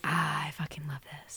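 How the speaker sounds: hushed, breathy whisper of delight; whispered bliss. Acted. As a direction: whisper it like a private, blissful realization. Voicing a rare moment of joy.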